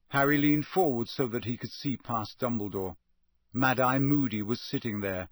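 ordinary delivery, nothing marked

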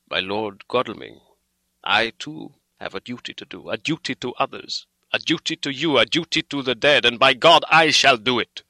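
starts measured, builds to a loud vow; grave and stern. A narrator's reading. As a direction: answer sternly, gathering force to the end. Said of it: "said gravely and sternly".

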